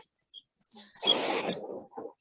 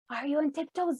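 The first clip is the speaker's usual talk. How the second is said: usual speaking voice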